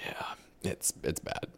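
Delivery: breathy near-whisper, barely voiced; whispered aside. Real, not acted. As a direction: drop to a whisper. Muttered aside about dating.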